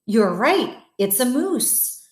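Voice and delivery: plain reading voice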